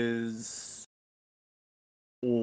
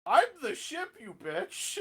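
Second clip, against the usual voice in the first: booming echoing villain monologue; slower than usual. Acted. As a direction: hiss it like a haughty ship AI. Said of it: "you hear Phoenix, like".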